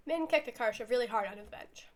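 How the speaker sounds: usual speaking voice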